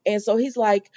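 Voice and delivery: ordinary talk, nothing marked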